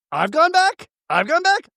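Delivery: high incredulous question; hammed-up disbelief, high; mock outrage. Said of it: "Mary's like".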